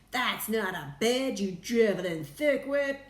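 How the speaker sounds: loud, exasperated insult; angry yell. A narrator's reading. President berating the astronaut, angry yell. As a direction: yell at an idiot underling. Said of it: "yelled the President".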